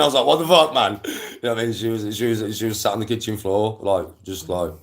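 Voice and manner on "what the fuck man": high, loud disbelief; retold shock. Running downstairs to partner, retold shocked outburst. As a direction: blurt it out louder and higher.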